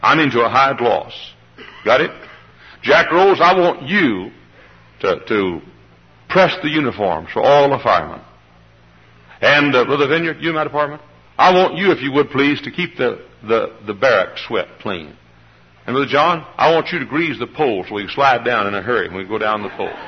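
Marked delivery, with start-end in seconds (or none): none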